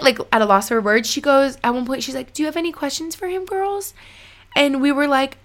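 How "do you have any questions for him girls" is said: lightly voiced medium asking, higher and fast; a hint of a chirpy psychic asking the girls; bright medium voice. Quoting the medium's question.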